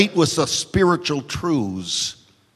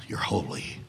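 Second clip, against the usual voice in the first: whispered, much softer and lower; reverent whisper; 13 semitones lower, 14 dB quieter than usual. Retold or imitated. Preacher as David whispering praise to God. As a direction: breathe holy out in awe.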